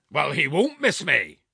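high, loud, forceful giant; giant's voice. A narrator's reading. Giant answering the challenge, loud and boastful. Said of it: "answered the giant".

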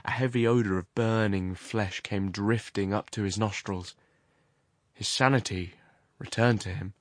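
plain reading voice

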